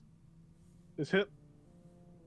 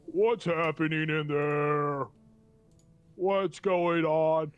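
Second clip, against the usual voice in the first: monodrone voice; 2 semitones higher, 5 dB louder, much slower than usual. Acted. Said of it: "in this very low monodrone voice".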